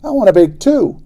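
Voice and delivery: high, loud plea; calling out. Acted. Boy shouting after the others, loud plea. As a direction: shout it after them as they run.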